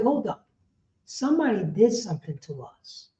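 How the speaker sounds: ordinary talk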